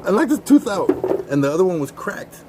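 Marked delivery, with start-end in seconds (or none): 0.1-0.9 laughing high and loud through the words; can barely get it out for laughing. Real, not acted. Telling friends about the toothless man, laughing loudly.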